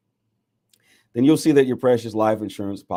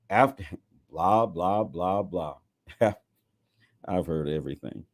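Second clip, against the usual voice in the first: laughing aside. Real, not acted. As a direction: laugh through it, amused. Laughing aside mid-reading.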